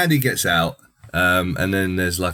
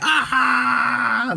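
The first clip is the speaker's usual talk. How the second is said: mocked pain cry. Warden stabbed in the leg by Chucky, mock yelp. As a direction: yelp as the scalpel goes in. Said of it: "he's like".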